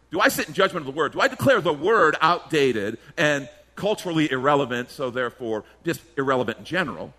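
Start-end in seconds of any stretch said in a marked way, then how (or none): none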